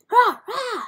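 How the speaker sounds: high, loud mock roar; short high-pitched yelled "rah" bursts; imitated roar. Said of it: "It's like".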